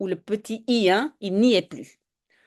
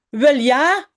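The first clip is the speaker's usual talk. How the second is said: dry, ironic mother's answer, a touch louder; ironic reply; 3 semitones higher, 6 dB louder than usual. Acted. Mother answers Jip ironically, dry mock agreement. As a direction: say it as if the answer is obviously no. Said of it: "c'est ironique".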